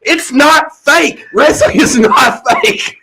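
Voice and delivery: NOT stretched out slowly, high pitch; emphatic insistence. Real, not acted. Mock insistence that wrestling is real.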